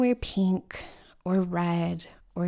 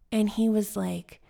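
ordinary delivery

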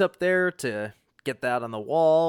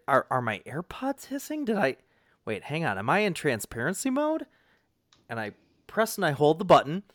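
ordinary delivery

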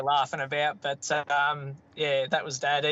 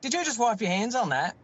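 higher, incredulous retold challenge; imitating dad; 8 semitones higher than usual. Dad challenging son over the grey rag, mock-affronted. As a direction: do Dad's affronted question, pitched up. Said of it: "and he goes".

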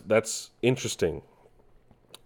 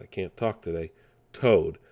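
normal talking voice

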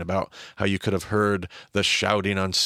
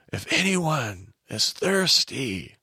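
higher, slow cry to the court; Jesus crying out in the silent temple, a hint of a call; 5 semitones higher, much slower than usual. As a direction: call out across a hushed court. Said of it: "here's Jesus standing on a dove crate".

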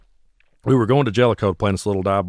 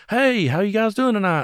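imitated stage banter, pitched way up; drummer addressing crowd; 11 semitones higher than usual. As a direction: greet a bar crowd in a high voice. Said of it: "takes it upon himself to talk to the crowd".

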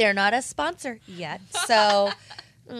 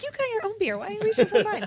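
mock indignant; 11 semitones higher, 5 dB louder, faster than usual. Mock-indignant quote to friend.

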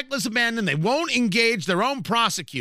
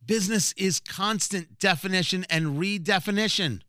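usual speaking voice

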